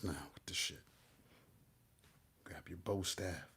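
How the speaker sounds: low, soft, half-whispered storytelling; soft hushed reading. Acted. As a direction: draw the listener in quietly like a secret.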